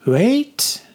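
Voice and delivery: a fortune teller commanding them to halt; husky foreign-accented mystic. A narrator's reading. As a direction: fortune teller halting the children, accented and mysterious. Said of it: "husky and heavy with a strange accent".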